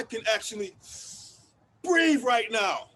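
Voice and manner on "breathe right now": loud and very slow, each word pressed; slow furious shout. Acted. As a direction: spit out every word in rage. Angry monologue about police.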